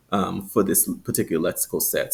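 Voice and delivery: ordinary talk, nothing marked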